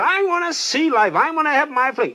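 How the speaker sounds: put-on high, eager young sailor. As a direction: beg for freedom like a restless youth. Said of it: "He said".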